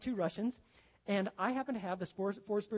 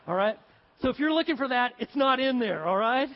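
much louder and higher, emphatic; loud aside; 5 semitones higher, 11 dB louder than usual. Real, not acted. Preacher booming to the congregation that the joke isn't in the booklet, loud. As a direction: project it out to the room with a grin.

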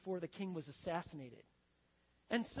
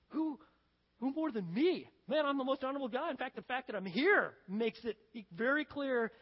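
quick, raised, boastful protest; self-important; 4 semitones higher, 5 dB louder than usual. Acted. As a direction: brag as the obvious favorite. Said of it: "so wrapped up in yourself".

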